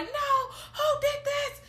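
high-pitched distressed wail; mock crying. Host voicing Rod crying and stressed, mock wailing. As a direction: imitate a panicked man crying out. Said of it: "he's crying and stressed".

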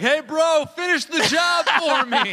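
high, drawn-out shouted holler; laughing, high-pitched mock shout; mock bro call-out. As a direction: holler it slowly like a bragging bro. Acts the ex calling out.

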